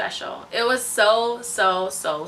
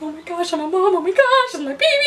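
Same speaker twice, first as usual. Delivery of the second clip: very high, louder, laughing and tearful; overwhelmed joy; 12 semitones higher, 3 dB louder, faster than usual. Retold or imitated. New mom holding her baby, very high, laughing through happy tears. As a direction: gush it, almost crying with happiness.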